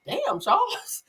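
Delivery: playfully taken aback; mock surprise. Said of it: "I was like".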